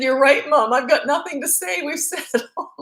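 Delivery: high, loud, laughing through the words; laughing softly. Retold or imitated. To her dying mother, a loving laughing reply. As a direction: retell the last joke with a laugh in it. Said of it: "And I was kinda laughing".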